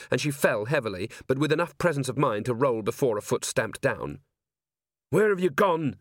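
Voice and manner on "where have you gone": high, contained yell; narrator's yell, raised in pitch. A narrator's reading. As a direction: call out for a missing partner. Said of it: "she yelled".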